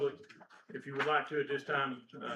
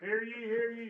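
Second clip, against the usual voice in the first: court crier call; 7 semitones higher, slower than usual. Acted.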